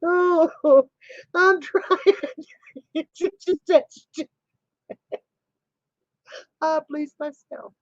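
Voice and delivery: high, weak voice between giggles; uncontrollable laughter. Real, not acted. Friend trying to finish through giggles, uncontrollable laughter. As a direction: struggle to get words out through laughing. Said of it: "I can't stop".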